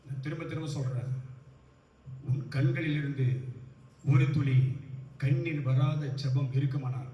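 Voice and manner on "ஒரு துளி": normal talking voice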